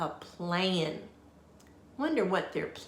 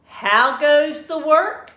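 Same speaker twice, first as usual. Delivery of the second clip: loud, high call from bed; lazy farmer shouting; 7 semitones higher, 11 dB louder than usual. A narrator's reading. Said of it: "called the farmer".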